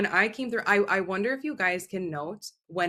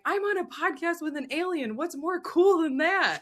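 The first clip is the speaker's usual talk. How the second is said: higher, amused excited quote; 11 semitones higher than usual. Retold or imitated. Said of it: "Rosanna was like".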